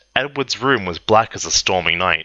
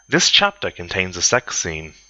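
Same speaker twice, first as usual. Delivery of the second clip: ordinary delivery, nothing marked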